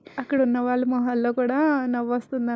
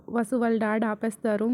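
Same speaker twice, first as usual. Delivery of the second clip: ordinary delivery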